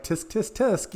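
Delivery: high-pitched mock tut-tutting; cheeky high tsk-tsk; playful scolding. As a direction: tease as if wagging a finger. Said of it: "still, like, being playful about it".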